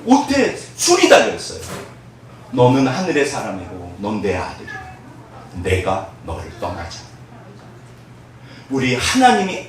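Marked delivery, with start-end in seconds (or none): 2.5-7.1 soft, low loving voice; God gently reassuring His son; tender divine promise. Acted. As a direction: murmur it like a father's vow. God speaks to His child.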